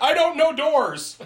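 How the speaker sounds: high, drawn-out mock monster cry; clueless monster. Cohost mocks the monster walking in backwards, high clueless whine. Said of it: "and he's like".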